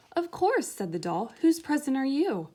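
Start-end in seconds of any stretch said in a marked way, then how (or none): 0.1-0.7 high, loud "of course" from a proud little doll. Acted. Doll answers Lamb. 1.4-2.5 high and louder, a doll asking with some importance. Acted. Doll questions Lamb.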